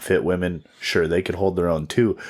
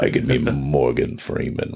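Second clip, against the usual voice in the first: Morgan Freeman; 8 semitones lower than usual. Pretend. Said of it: "I wouldn't say that's Morgan Freeman".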